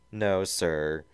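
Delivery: plain reading voice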